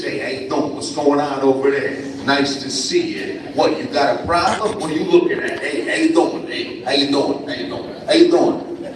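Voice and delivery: hammed-up Paterson New Jersey tough-guy talk; New Jersey accent. Guest speaker mimicking his Jersey boyhood self for fifth graders, New Jersey accent. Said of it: "I talked a little like this".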